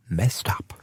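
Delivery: whispered to himself in dismay; hushed, hurt whisper. Acted. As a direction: breathe the words out, stunned. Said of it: "repeats the accusation, then whispers it".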